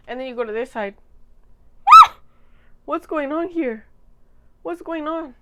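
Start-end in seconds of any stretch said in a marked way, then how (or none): 1.0-2.8 sharp loud high gasp; shocked gasp. Real, not acted. Sees her hair on other side. 2.9-5.3 surprised. Real, not acted. Shocked at her hair.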